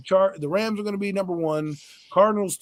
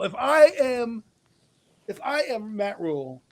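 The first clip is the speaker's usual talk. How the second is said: ordinary talk, nothing marked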